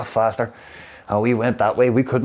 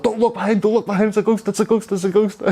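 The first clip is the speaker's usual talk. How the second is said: high, panicky chant of warning; mock panic; 9 semitones higher than usual. Friend on the bike warning him of a ghost, panicked. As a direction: squeal it like you're spooked. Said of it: "Kay was like".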